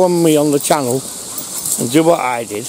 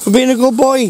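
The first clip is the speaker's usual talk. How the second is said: higher and louder, treat in hand; excited dog praise; 8 semitones higher, 3 dB louder than usual. Real, not acted. Owner showing the dog a treat, higher and louder. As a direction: gush over the dog.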